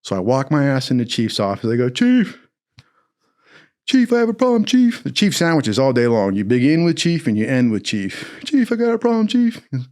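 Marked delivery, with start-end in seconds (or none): 1.9-5.0 high-pitched imitation of a recruit; mock recruit pleading for attention; recruit calling his chief. 8.5-9.6 high-pitched imitation of a recruit; mock recruit pleading for attention; recruit calling his chief.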